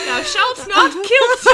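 laughing quote. Real, not acted. Co-host repeats the blooper, giggling slowly.

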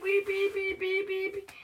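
sing-song mock alarm beeps. Child voicing the cage alarm, playful mock beeping.

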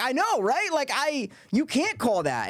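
high, animated I-know-right; eager agreement. Retold or imitated. Player agreeing eagerly with the table, high animated voice. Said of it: "And I'm like".

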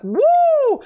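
loud, high joyful whoop; woo. Retold or imitated. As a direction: shout it like a thrill ride. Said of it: "I'm like".

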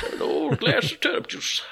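high, husky whispered old-man impression; joking croak of an old cartoon man. Host plays old man from Shelbyville with turnip juice, whispery old-man voice.

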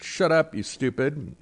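usual speaking voice

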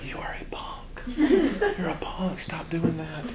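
slow whispered taunt, imitating a teen; mock tough whisper. Imitating son at youth group.

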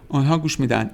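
ordinary talk

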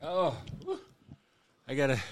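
panting after singing; breathless, catching your breath. Real, not acted. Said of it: "I gotta catch my breath".

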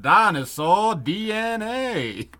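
high, very drawn-out cartoon announcement; slow, exaggerated put-on voice; hammed-up topic reveal. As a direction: stretch every syllable into a silly reveal.

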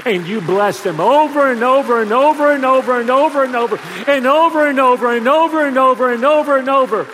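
rising preaching. Acted. Preacher urging parents to bless children, building. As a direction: pound the repetition home.

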